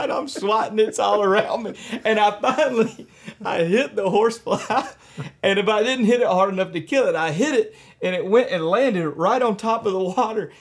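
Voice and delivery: high and loud, laughter bubbling through; laughing while telling. Real, not acted. Describing to the host how the bass ate the horsefly, laughing. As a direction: tell it as you keep cracking up.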